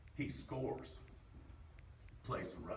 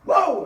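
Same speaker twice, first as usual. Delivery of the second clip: excited whoop; 27 dB louder than usual. Real, not acted. Hyping up the team.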